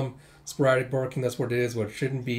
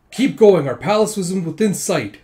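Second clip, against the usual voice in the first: raised, louder commander's urging; rallying call; 5 semitones higher, 8 dB louder than usual. Retold or imitated. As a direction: urge the troops onward. Retold commander urging troops on.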